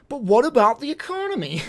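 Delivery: high, mock-worried voice; clueless comic voice. Comic character fretting about money, mock worry. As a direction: ask it like a clueless pundit. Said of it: "then someone's like".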